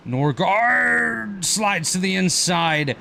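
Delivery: slow, high, shouted pirate drawl. Real, not acted. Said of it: "I sounded like a pirate there".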